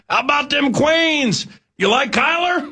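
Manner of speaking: high-pitched heckler hollering at a runner; yelling rube. Acted. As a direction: holler it like an obnoxious sports fan from the roadside. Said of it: "some rube running alongside you yelling".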